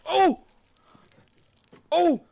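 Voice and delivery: very high, loud burst of shock; shocked yell. Real, not acted. Viewer stunned by the twist, high shocked yell. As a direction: explode at the twist.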